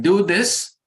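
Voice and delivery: loud, high mock order; teacher's command. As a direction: bark it out like a strict teacher. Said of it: "The teacher may say".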